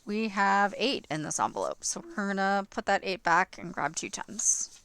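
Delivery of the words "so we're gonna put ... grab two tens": ordinary delivery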